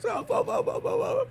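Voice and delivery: high squeaky mock whimper of a scared rat. Mobster imitating the snitch whimpering in terror. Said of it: "He's like".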